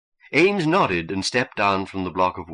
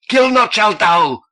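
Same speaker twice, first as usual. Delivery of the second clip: very high, loud, slow scream; 13 semitones higher, 8 dB louder than usual. A narrator's reading. Cultist screaming a reversed commandment, very high and loud. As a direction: scream it across the room. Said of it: "screamed Ed Chambers".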